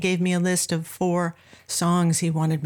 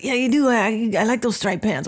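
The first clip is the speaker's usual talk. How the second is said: lightly imitated jazzman, insisting; a hint of impersonation in a back-and-forth squabble. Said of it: "They both kinda talk like this".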